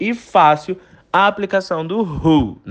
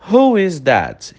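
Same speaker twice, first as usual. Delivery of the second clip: slow, clear English model phrase; teacher modelling slowly for repetition; slow model sentence; slower than usual. Shown as a demonstration. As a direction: say it slowly so students can repeat. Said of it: "Repete a pronúncia comigo".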